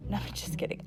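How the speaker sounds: whispered aside; quick hushed correction; whispering. Real, not acted.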